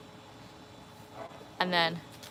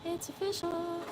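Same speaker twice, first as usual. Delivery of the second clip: much higher, slow, put-on "official"; silly high voice; 10 semitones higher, 7 dB quieter, slower than usual. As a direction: announce it in a goofy high voice. Silly high announcement repeat.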